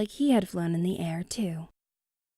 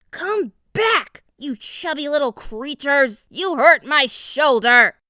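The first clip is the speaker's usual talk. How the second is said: much higher, louder, slow angry howl; hurt wolf howling at fleeing beavers; furious wolf howl; 7 semitones higher, 8 dB louder than usual. A narrator's reading. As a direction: bellow after them in pain and rage. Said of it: "the wolf howled at the beavers".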